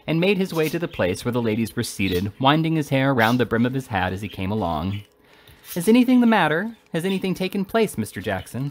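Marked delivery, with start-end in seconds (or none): 5.8-8.7 eager. A narrator's reading. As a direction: press for news eagerly in a raised pitch. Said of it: "said Missus Bardell eagerly".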